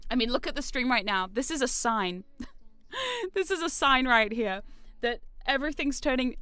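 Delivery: normal talking voice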